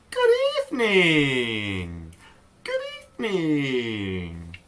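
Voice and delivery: slow glide down from high. Shown as a demonstration. Teacher gives one last one to echo, sliding downward. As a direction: start high and slide slowly down.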